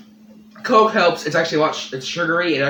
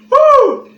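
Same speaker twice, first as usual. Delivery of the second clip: pained whoop; 12 dB louder than usual. Real, not acted. Burning mouth reaction.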